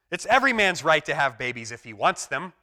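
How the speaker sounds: a hint of comic indignation; indignant Stan. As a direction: declare it as a grand right. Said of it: "Stan insists".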